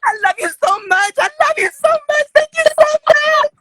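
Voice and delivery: high loud squealing scream, building; over-the-top gushing. Pretend. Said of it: "if you sound like that".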